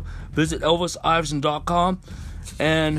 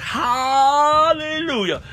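long, high, drawn-out cry; exultant praise cry; 9 semitones higher, 4 dB louder, much slower than usual. Real, not acted. Preacher praising God over signs and wonders, high drawn-out cry. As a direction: stretch it out high as a burst of praise.

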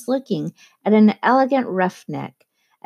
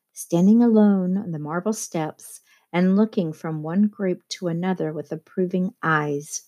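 ordinary delivery

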